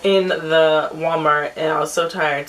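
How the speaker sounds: ordinary talk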